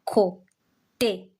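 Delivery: slow "À côté" with a gap after each syllable; teacher's measured, separated "À côté". Shown as a demonstration. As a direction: spell out "À côté" by sound, final é clear. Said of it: "That is why we say".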